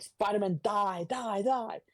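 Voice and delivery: high-pitched mock villain cry; Vulture. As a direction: play an attacking villain, pitch climbing with each threat. Voices the Vulture attacking Spider-Man.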